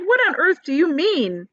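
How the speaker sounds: high, startled demand; alarmed cry. Acted. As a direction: ask in shock. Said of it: "they cried".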